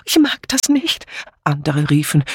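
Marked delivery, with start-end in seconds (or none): 0.0-1.0 high-pitched plaintive whine; loud whining. A narrator's reading. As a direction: whimper it like a frightened complainer. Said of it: "Dora Banner jammerte laut". (jammernd, mit hoher Stimme)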